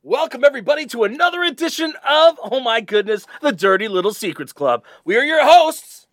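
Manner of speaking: high, loud hype-man welcome; hyped welcome. Acted. Co-host welcoming listeners with high loud hype. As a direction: pump up the crowd.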